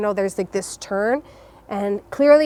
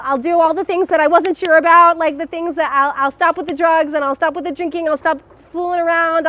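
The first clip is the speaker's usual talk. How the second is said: higher and louder, pleading vows to God; desperate bargaining prayer; 6 semitones higher, 6 dB louder than usual. Retold or imitated. Speeding car, she begs God and makes vows, higher and louder. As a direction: bargain for her life, rising and louder.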